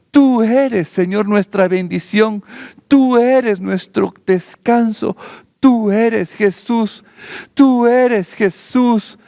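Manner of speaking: oración ferviente de adoración; fervent prayer. Acted. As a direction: pour it out to God, pleading and adoring. Pastor turns to fervent prayer.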